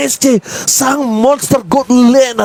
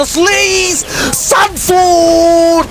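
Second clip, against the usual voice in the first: shouted esports play-by-play call; hype shout; 6 semitones higher, slower than usual. Acted. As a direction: yell the big play call.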